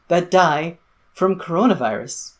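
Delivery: ordinary talk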